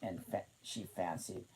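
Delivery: usual speaking voice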